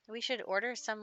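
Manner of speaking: ordinary talk, nothing marked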